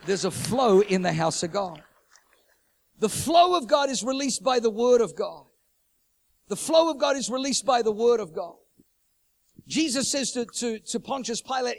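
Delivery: full-voiced sermon climax; preaching at full force. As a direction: thunder it out to the church.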